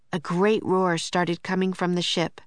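ordinary delivery, nothing marked

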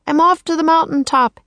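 high raven character voice; croaking. A narrator's reading. Teddy flying by the others, high croaking call. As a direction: announce it high, like a bird calling. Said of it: "he croaked".

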